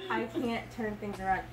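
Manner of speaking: normal talking voice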